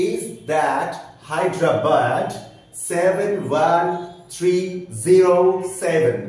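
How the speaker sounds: over-articulated British pronunciation, slow and deliberate; teacher distorts the phrase into British English so the vowels come out clearly; exaggerated British accent. Shown as a demonstration. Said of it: "মানে বিকৃত করে উচ্চারণ করছি".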